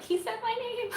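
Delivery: high-pitched fangirl squeal, played up; hammed-up giddy squeak; mock swooning. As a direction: squeal it like a smitten fan. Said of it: "I'm like".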